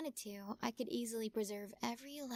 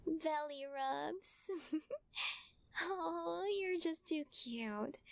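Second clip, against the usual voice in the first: put-on high, slow cooing baby talk with a giggle; baby talk to a cat; 6 semitones higher, much slower than usual. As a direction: gush over the kitten like a pet owner. Fussing over the new kitten.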